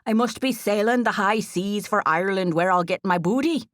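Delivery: hammed-up pirate talk; playful pirate. A narrator's reading. Joking about sailing to Ireland, mock pirate voice. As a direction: play a swaggering pirate for a laugh. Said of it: "I totally meant it in a pirate booty way".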